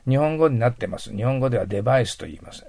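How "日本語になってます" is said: ordinary delivery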